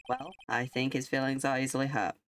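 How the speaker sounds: ordinary talk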